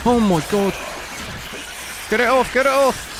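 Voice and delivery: high panicked shriek; phobic panic. Real, not acted. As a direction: freak out, get it off you. Jump scare, creature on him.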